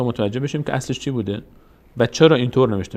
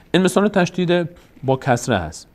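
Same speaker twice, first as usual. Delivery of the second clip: usual speaking voice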